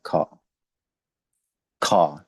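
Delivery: खा with a breathy kh; pronunciation demo. Shown as a demonstration. Teacher to class, modeling the sound. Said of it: "खा की sound देता है".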